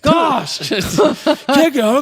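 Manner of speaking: very high mock child's shout; hammed-up scolding kid. Boy calling his sister back to the car, mock high scolding. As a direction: squeal it like an exasperated little brother, half laughing.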